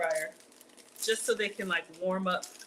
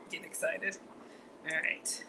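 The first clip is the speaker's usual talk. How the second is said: whispering; 2 semitones lower, 7 dB quieter than usual. Real, not acted. Muttered aside.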